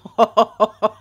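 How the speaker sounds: loud burst of laughter; real laugh. Real, not acted. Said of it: "I'm kind of laughing".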